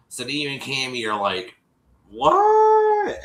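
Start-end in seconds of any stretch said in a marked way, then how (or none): 2.1-3.2 high, loud shocked what; stunned what. Retold or imitated. Hosts hearing the catfish news, a loud shocked yelp. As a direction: act out the hosts' jaw drop.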